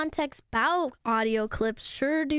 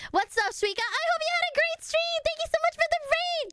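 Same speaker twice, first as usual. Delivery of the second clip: high, bright streamer hype; excited thanks; 13 semitones higher than usual. Real, not acted. Streamer thanking Suika for the raid, high and excited. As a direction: greet the raider with a big lift.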